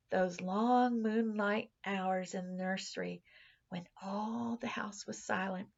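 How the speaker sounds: ordinary delivery, nothing marked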